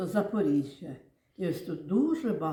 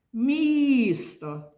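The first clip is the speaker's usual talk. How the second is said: МІСТО with a long, high МІ; calling out the word; 6 semitones higher, 4 dB louder than usual. Shown as a demonstration. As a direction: call МІСТО, stretching the І then falling. Teacher calls word to show stress.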